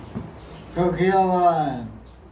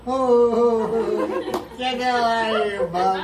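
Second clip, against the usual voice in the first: a touch of a higher, laughing "get out" yell; mock annoyed neighbor; 5 semitones higher, slower than usual. Retelling how she shoos the cuckoo, laughing mock yell. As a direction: laugh through a mock shout at the bird. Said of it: "They make fun of her".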